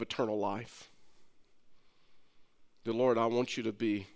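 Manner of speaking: sinner's prayer. Acted. Pastor leading the congregation in prayer, soft and low. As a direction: pray it humbly, leading them line by line. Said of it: "Just ask him".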